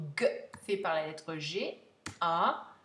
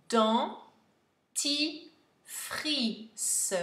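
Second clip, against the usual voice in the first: "dentifrice" very slow, higher, with gaps between syllables; 5 semitones higher, much slower than usual. Shown as a demonstration. Teacher dictating a new word, syllable by syllable. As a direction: break "dentifrice" into isolated syllables.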